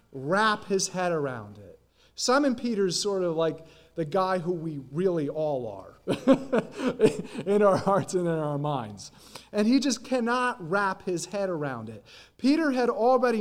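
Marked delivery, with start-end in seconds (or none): 6.1-7.4 light chuckle. Real, not acted. Laughs at own remark.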